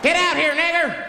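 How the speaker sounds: high, loud yell at someone indoors; acted mob member hollering; mock threatening shout. Hooded man yelling at caller inside house, mock shout.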